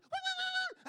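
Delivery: high yapping dog calls, loud; imitated wild dog yelps. Photographer mimics the wild dogs chasing the impala, high loud yaps. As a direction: yelp like a hunting dog.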